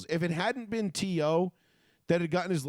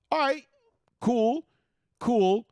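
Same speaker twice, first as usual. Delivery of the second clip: sarcastic cool; 7 semitones higher, 5 dB louder, slower than usual. Real, not acted. Sarcastic reaction to rule changes.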